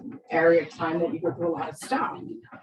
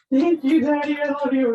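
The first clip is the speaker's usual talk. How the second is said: put-on high, louder sugary teen thanks; gushing daughter; 8 semitones higher, 5 dB louder than usual. Daughter hugging her dad, gushing high and sweet. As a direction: gush it at daddy. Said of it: "So she hugs him".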